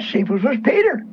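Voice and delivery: ordinary talk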